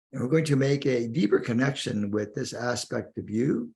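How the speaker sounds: ordinary talk, nothing marked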